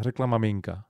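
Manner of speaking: ordinary delivery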